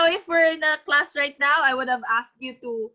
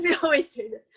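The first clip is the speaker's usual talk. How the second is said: normal talking voice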